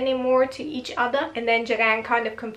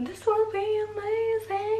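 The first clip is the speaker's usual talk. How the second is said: mock excited gush; 10 semitones higher, 5 dB quieter than usual. Excited about trailer scene.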